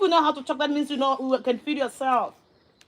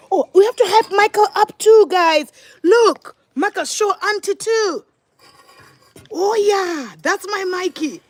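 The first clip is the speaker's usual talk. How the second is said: hyping up; 7 semitones higher, 4 dB louder than usual. Real, not acted. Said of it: "keep on, um, hyping him up".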